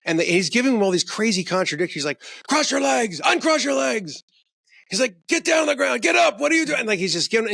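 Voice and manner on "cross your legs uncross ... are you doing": high, shouted contradictory commands; shouted cop orders. Retold or imitated. Retelling the cop yelling orders in the hallway. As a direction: yell them like a bullying cop.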